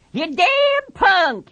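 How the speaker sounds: high, drawn-out insult; jeering Carlson. Acted. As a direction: jeer at him, stretching the words.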